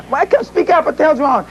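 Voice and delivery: retold alarm at a hushed caller; alarmed demand. Alarmed reply to whispering employee.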